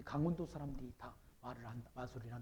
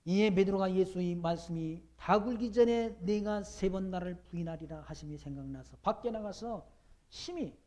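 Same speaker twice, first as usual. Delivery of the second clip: loud, higher, slow preached reading; scripture reading; 4 semitones higher, 7 dB louder, slower than usual. Acted. Pastor reads Peter's denial to the congregation, proclaiming loudly. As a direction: proclaim the verses to the whole hall.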